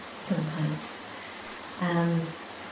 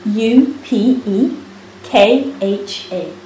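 measured, raised spelling with each letter set apart; slow, clear spelling; 3 semitones higher, 5 dB louder, much slower than usual. Real, not acted. Teacher repeating a spelling for a listener, slow and clear.

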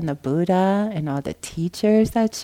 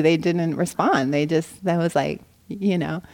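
ordinary delivery

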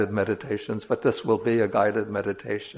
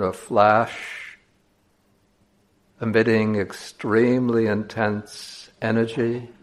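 slow, measured meditation voice with long pauses; guided meditation; slower than usual. Acted. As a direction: guide listeners slowly, leaving space between phrases.

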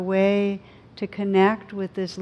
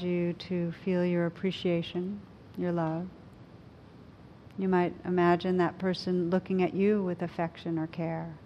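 guided meditation. Acted. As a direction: gently guide them inward.

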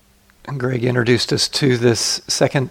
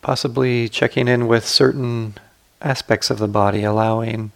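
guided meditation. Acted. Teacher guiding sitters to relax the body, calm guided meditation.

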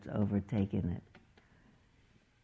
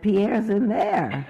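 louder, slow, laughing through it; laughing father; 9 semitones higher, 9 dB louder, slower than usual. Acted. Father telling mother where Pierre is, laughing. Father realizes lion ate son.

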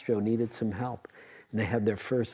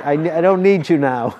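loud, higher words through laughter; laughing; 6 semitones higher, 13 dB louder than usual. Real, not acted. Teacher in an amused aside, laughing. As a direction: say it while breaking up laughing.

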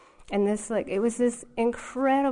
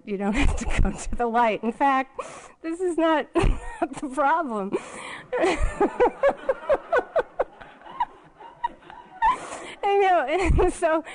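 laughing; 8 semitones higher, 5 dB louder than usual. Real, not acted. Reassuring dying dad, laughing.

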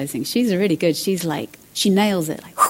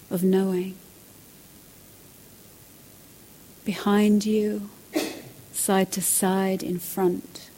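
sleep story narrator. Acted. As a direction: lead them gently into widening. Guided awareness practice.

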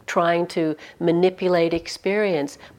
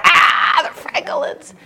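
mock outburst; 11 semitones higher, 4 dB louder, slower than usual. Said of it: "you know, like".

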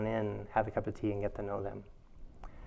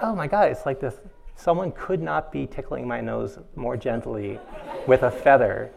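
louder, laughing comic outrage; laughing mock outrage; 9 dB louder than usual. Teacher retelling her itch ordeal, laughing mock outrage. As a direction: rant at the itch while laughing.

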